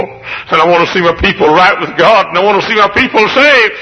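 high ringing preacher's cry; full-force preaching. Acted. Preacher to his congregation, full-force preaching. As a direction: lift it up like a revival preacher.

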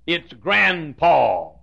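grandpa very slow and drawn; slow drawled grandpa; southern grandpa. Shown as a demonstration. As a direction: stretch out grandpa slowly. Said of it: "Or grandpa".

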